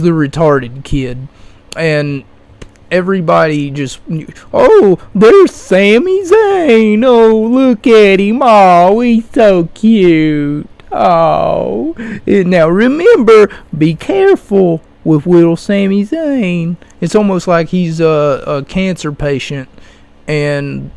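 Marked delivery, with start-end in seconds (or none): 4.5-11.9 put-on high, drawn-out baby-talk gushing; mocking, syrupy fawning at higher pitch; gushing cooing. Mock crowd cooing over a wrestler. 12.2-16.8 put-on baby-talk warning, pitched up; patronizing sing-song baby talk; mock coddling. Mock coddling voice about the wrestler.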